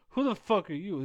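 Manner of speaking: same question at full energy; high-energy yell. Shown as a demonstration. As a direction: bark it at a stranger who walked up. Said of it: "It's just the energy level is different".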